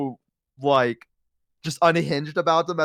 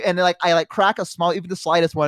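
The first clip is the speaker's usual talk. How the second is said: usual speaking voice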